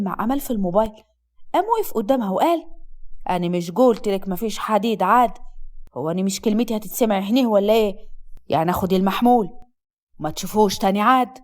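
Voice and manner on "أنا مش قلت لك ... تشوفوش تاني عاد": low, stern male scolding; threatening husband. Acted. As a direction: warn her in a deep, commanding voice.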